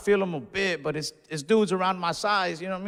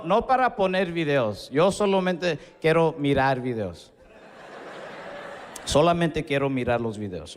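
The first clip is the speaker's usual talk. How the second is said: a lightly delivered Spanish bit; joke told in Spanish. Acted. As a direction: switch into Spanish storytelling. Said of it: "funnier in Spanish".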